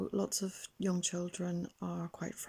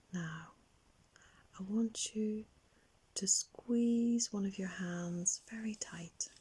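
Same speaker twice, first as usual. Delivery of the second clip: guided relaxation. Acted. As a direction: gently lead a young child into calm, lingering on key words. Leads children's guided relaxation.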